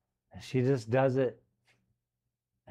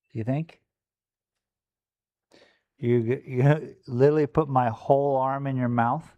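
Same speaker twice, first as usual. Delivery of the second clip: ordinary delivery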